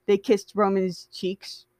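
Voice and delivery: plain reading voice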